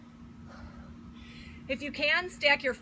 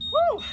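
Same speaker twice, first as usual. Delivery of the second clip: high, loud celebratory woo; excited whoop; 12 semitones higher, 5 dB louder than usual. Real, not acted. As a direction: whoop with relief and joy. Cheering after finishing workout.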